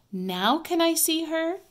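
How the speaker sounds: eager, high-pitched plea; begging. A narrator's reading. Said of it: "begged Ramona".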